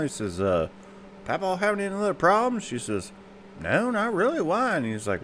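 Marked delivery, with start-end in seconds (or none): 1.2-2.6 doctor voiced much higher. Doctor asking mama about papa, much higher. 3.6-4.8 old mama voiced much higher. Mama answering the doctor in the waiting room, much higher.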